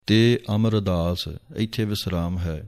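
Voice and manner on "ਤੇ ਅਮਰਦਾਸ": ordinary talk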